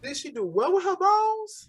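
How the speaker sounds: high, loud disbelief; startled, high-pitched double take; incredulous. Real, not acted. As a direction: blurt it out, completely baffled. Baffled by unfamiliar Southern phrase.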